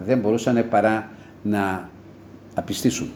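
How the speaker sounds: normal talking voice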